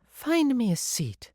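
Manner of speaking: high, slow, entitled woman voice; high-pitched demanding customer; demanding guest. A narrator's reading. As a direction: order it slowly in a high, imperious voice.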